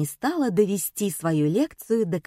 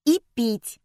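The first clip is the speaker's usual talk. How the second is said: put-on higher, complaining child; pestering girl's whine; whining; 5 semitones higher, 3 dB louder than usual. A narrator's reading. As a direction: add a whiny demand. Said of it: "заныла Катя".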